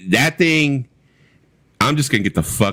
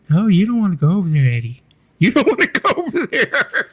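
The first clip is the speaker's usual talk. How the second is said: high falsetto, louder warning; hammed-up high-voiced star impression; 13 semitones higher, 3 dB louder than usual. Star telling [name] to keep away, high falsetto impression. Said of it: "he does an impersonation of [name]".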